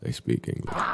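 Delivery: whispered sarcastic repeat; under-breath aside. Real, not acted. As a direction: breathe it out softly, just to yourself.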